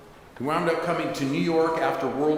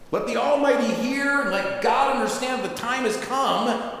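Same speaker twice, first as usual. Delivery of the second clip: yelled out; 10 semitones higher than usual. Acted. Rabbi shouting to the congregation for God to hear. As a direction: cry it out to heaven. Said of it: "He yelled out".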